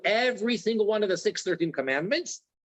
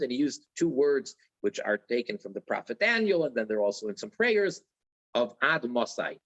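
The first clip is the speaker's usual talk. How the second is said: ordinary talk, nothing marked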